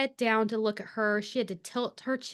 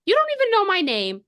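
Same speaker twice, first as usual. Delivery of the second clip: higher and louder, playful indignation; louder, higher teasing outrage; mock indignant; 11 semitones higher, 7 dB louder, faster than usual. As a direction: protest half-flirting. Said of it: "And she's like".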